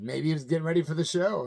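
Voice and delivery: ordinary talk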